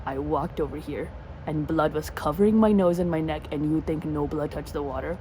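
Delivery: low, disbelieving retort; low deadpan disbelief; incredulous. Retold or imitated. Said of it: "I'm like".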